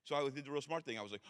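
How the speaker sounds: ordinary delivery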